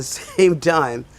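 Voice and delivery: ordinary talk, nothing marked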